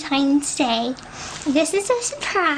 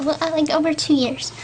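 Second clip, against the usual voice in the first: normal talking voice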